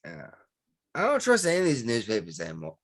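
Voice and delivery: talking through hiccups; words broken by hiccups. Real, not acted. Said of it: "I can't stop the hiccups".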